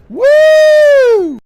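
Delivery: very high, loud reckless whoop; high excited whoop. Acted. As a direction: cheer like a teen diving in. Teenager voice cheering.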